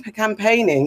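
normal talking voice

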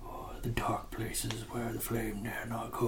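slow, hushed wizard whisper; ominous whisper. Retold or imitated. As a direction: whisper it slowly like a dire warning. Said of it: "he has this whole thing about".

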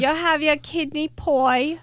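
hammed-up, off-target British accent; bad British accent. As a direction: attempt a British accent and miss. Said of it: "I can't do a British".

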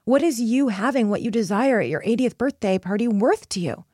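ordinary talk

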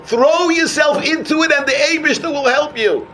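high-pitched, fervent urging; rousing exhortation. Real, not acted. Preacher to struggling learners, rousing exhortation. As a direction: urge them on, pitch lifted high.

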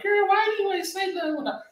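high-pitched mock complaint; put-on squeal of reproach; confronting friend. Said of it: "They're like".

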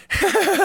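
high-pitched manic cackle; short, terrifying high laugh; imitated crazed laugh. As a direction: cackle high like someone possessed. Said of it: "like, laughing and ro-, like, very terrifying".

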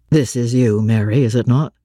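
deep, uncertain master; low and doubtful, testing; low Rochester voice. A narrator's reading. Blind master unsure who is there, low and doubtful.

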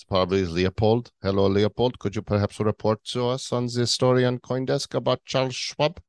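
mock German-accented anchor. Host calls on correspondent Leopold, in a German accent. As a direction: hand over in a put-on German accent. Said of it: "Austrian or German name".

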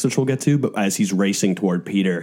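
normal talking voice

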